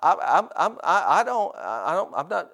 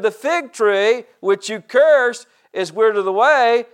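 loud, high, slow restatement of scripture; emphatic preaching; 8 semitones higher, 8 dB louder, slower than usual. Real, not acted. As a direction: proclaim it slowly, hitting each key word. Repeats the verse, preaching it.

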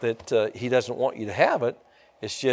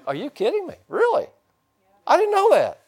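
amazed disbelief. Retold or imitated. People learning their benefits.

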